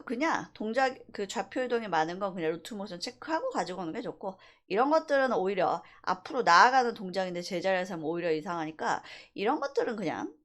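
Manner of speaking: ordinary talk, nothing marked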